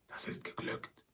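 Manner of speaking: soft unvoiced whisper. A narrator's reading. Old Shatterhand whispers to Winnetou over the bound guards, whispering. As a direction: breathe it out with no voice. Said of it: "flüsterte Old Shatterhand".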